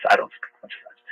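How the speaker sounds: usual speaking voice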